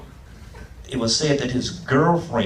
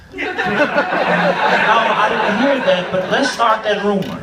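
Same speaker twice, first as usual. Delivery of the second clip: laughing; 8 semitones higher, 3 dB louder, slower than usual. Real, not acted. Laughing at death rumor.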